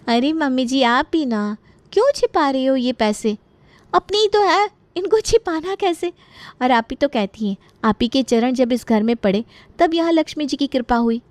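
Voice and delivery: butter up the mother-in-law with sly sweetness; coaxing daughter-in-law. Acted. Daughter-in-law coaxes caught mother-in-law.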